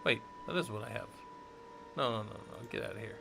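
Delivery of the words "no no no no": ordinary talk, nothing marked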